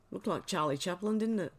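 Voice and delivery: normal talking voice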